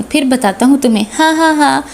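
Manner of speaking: plain reading voice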